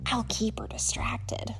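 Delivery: soft unvoiced whisper. A narrator's reading. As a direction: whisper the plan in secret. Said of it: "she whispered".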